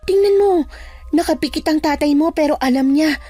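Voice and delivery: batang kasama sa kuwento. Acted. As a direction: reason it out in a high character voice. Narrator voices Sol in the story. (mataas na boses ng tauhang nagpapaliwanag)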